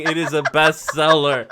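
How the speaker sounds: normal talking voice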